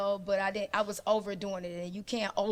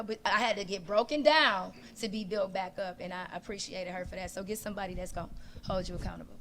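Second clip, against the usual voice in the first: usual speaking voice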